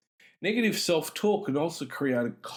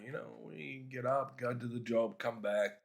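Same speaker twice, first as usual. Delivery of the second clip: much softer, offhand listing of a dull routine; mock plodding routine; 8 dB quieter than usual. Speaker to audience, mimicking men plodding through the day, much softer and offhand. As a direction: toss it away quietly, like a bored bloke's daily grind.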